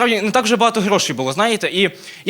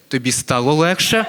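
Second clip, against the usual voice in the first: mock caring mother; 6 semitones lower, slower than usual. Mother pulls him out by hair.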